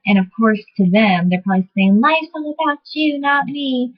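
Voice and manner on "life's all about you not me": imitated arguing neighbor. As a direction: mimic a neighbor's outburst in a raised pitch. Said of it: "They're probably saying it like that".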